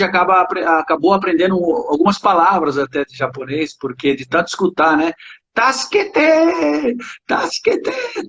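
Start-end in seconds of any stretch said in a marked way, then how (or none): 5.5-8.3 high, drawn-out Japanese cry; playful imitation of a dubbed-show plea, voice pitched way up; mock cry for help. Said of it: "brincava muito com isso". (grito de socorro imitado, agudo e arrastado)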